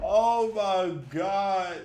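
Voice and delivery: drawn-out exasperation. Real, not acted.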